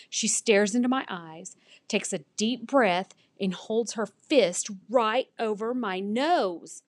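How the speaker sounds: plain reading voice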